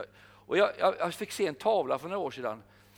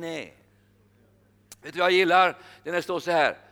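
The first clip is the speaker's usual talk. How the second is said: ordinary delivery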